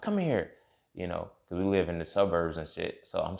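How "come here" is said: calling out. Retold or imitated. Mom calling him in.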